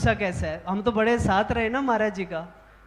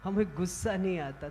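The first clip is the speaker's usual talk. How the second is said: low, soft, unhurried denial of anger; imitating a serene guru, very quietly; smiling calm guru; 7 semitones lower, 12 dB quieter than usual. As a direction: say it gently, completely unruffled. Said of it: "गुरुजी मुस्कुराने लगे".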